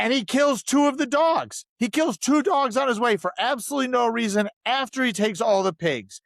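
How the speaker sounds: rising outrage, pitched well up; indignant outburst. Real, not acted. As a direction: vent about the dogs, pitch climbing. Outraged about dogs being killed.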